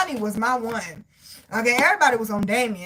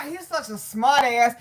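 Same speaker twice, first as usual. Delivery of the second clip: ordinary talk, nothing marked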